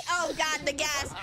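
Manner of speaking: ordinary talk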